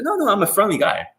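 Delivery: lightly acted, high-voiced plea of harmlessness; big guy protesting friendliness. Big guy to the person he startled, mock reassuring.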